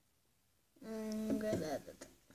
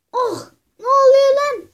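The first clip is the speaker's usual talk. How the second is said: exasperated shout; 7 semitones higher, 7 dB louder than usual. Acted. Caught again, yelling.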